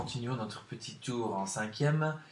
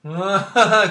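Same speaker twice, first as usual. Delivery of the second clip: burst of laughter; 8 semitones higher, 16 dB louder than usual. Real, not acted. Host laughs at the joke.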